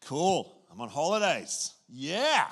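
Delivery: loud, high, giddy whoop; hammed-up holiday cheer; mock holiday glee. Preacher mocks a holidaymaker whooping with joy. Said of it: "you know, or".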